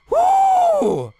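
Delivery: very high, loud whoop; mock bored scream. Real, not acted. Gamer pretending she only screams from boredom, whooping high and loud. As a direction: let out a wild whoop to shake off the fear. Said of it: "I scream when I get bored of things".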